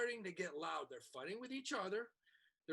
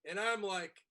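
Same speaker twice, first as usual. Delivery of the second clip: usual speaking voice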